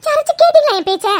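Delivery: very high-pitched wail of blame; distressed mother crying out in accusation; anguished reproach. Acted. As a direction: lash out because nobody listened. Upset mother blames the others.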